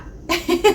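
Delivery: short high sheepish giggle. Real, not acted. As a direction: giggle at her own impatience. Laughs at her own impatience.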